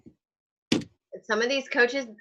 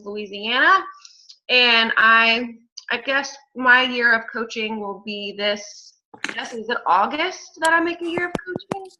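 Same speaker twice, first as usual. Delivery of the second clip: normal talking voice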